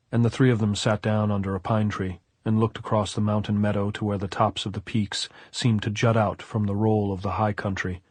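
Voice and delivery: usual speaking voice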